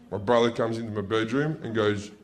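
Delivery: normal talking voice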